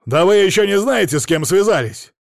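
loud, high, indignant bluster; outraged big-shot shouting; angry threat. A narrator's reading. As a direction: warn them off at full volume. Red-faced man's tirade at policeman.

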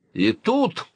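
ordinary delivery

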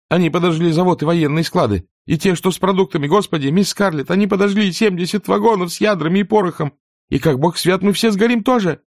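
put-on high, breathless frightened girl; a terrified young maid wailing; panicked servant girl. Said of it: "задыхаясь, выкрикнула Присси".

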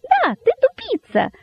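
extremely high, squeaky glee; rapturously shouting rabbit. A narrator's reading. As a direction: squeal the insult in delight. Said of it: "восторженно выкрикнул кролик".

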